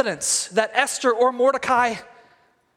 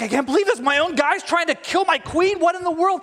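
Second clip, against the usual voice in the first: higher, mock-indignant rant; enraged king; 6 semitones higher than usual. Enraged king rants alone in the garden, mock outrage. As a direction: play an incredulous angry king lightly. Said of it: "the king is so enraged".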